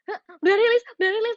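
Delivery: high-pitched mock panic; panicked. Singer reliving her song's early release, mock panicked. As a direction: blurt it in a re-enacted fluster. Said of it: "aku kayak panik gitu".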